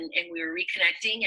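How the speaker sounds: ordinary talk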